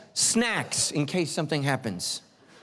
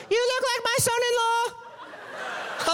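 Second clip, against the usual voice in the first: put-on much higher, slow, quavering old lady; much slower than usual. Lady speaking through the window crack, high old lady voice. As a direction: squeak it out like a nervous grandma. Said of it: "and she goes".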